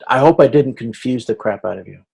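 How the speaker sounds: usual speaking voice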